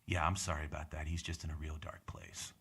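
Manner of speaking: low, soft imitated apology; hushed apology. Comic imitates heckler's buddy apologising, hushed and low. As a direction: drop right down, as if confiding an excuse.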